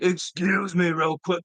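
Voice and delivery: slow, drawn-out mock Satan voice; talking like Satan. Student addressing women, slow mock-Satanic voice. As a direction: imitate a student sounding demonic, dragging the words. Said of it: "sounds like he's talking like Satan".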